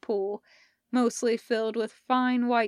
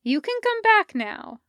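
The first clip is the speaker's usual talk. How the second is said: Parker's voice; 11 semitones higher, 5 dB louder than usual. Acted. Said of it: "she told them".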